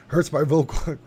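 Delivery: a hint of a gruff wrestler rasp; lightly voiced Hulk Hogan. Streamer trying the requested Hulk Hogan voice, light wrestler rasp. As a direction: tease the wrestler voice through a laugh. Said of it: "Can you do Hulk Hogan's voice?".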